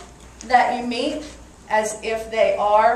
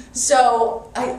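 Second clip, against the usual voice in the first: ordinary delivery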